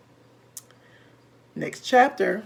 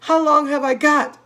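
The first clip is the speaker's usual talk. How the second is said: higher, louder panicked plea; frightened cry; 6 semitones higher, 6 dB louder than usual. A narrator's reading. Wife pleading in terror with husband. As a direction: beg in terror. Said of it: "cried Missus Twit".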